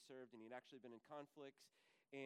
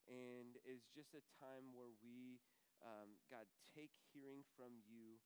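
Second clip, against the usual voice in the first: soft, lower praying voice with long pauses; prayer; 3 semitones lower, 4 dB quieter than usual. Real, not acted. Pastor asking God to open hearts, quiet prayer. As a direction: pray quietly, searching for words.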